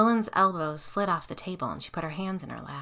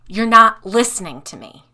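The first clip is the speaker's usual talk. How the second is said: louder, spat out in fury; angry hiss; 4 semitones higher, 15 dB louder than usual. Acted. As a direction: hiss at her that she isn't listening. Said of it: "she hissed".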